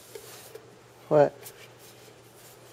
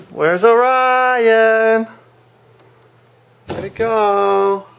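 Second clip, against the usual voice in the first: slow playful; 3 semitones higher, much slower than usual. Acted. Playing hide and seek.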